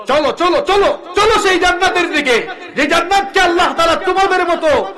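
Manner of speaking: high, loud, driving repeats of চলো; urgent rallying cry. Acted. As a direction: push them forward with every call. Urging to march.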